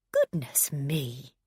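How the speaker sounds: slow cooing; mother-in-law cooing smugly; cooed. A narrator's reading. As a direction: coo it sweetly. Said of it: "she cooed".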